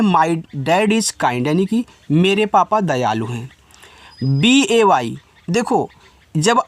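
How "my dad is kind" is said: very slow, carefully pronounced English; slow model sentence. Shown as a demonstration. Hindi teacher models English slowly for students. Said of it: "आप बोल सकते हैं".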